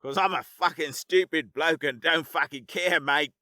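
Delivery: put-on Australian accent with a laugh in it; laughing Aussie. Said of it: "he laughed heartily".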